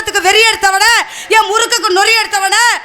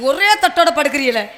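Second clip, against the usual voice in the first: softer, lower, laughing as she mocks him; laughing tease; 5 semitones lower, 4 dB quieter than usual. Real, not acted. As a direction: giggle through the teasing question. Teasing the man mockingly. (சிரித்துக்கொண்டே கேலி)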